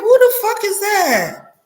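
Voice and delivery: high, loud, slow shocked question; who the fuck said high and aghast; shocked disbelief. Retold or imitated. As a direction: gasp at the stranger you see. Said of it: "And I'm like".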